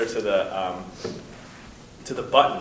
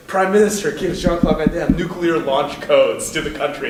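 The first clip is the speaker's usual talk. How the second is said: mock headline; 5 semitones higher, 7 dB louder than usual. Mock newspaper headline in sketch.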